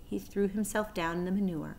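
ordinary delivery

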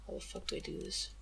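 muttered to self. Real, not acted. Fiddling with menu, muttering.

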